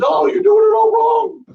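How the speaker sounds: very high, drawn-out shouted imitation; hammed-up high yell of correction; mock heckler outburst. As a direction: squawk it like an interfering know-it-all. Mimicking a heckler who jumps in.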